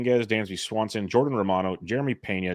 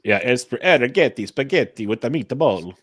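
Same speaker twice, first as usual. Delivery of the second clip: hammed-up Italian accent, higher and lilting; bad Italian accent; 4 semitones higher, 4 dB louder than usual. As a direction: do a cheesy, badly faked Italian accent. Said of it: "That's a bad Italian accent".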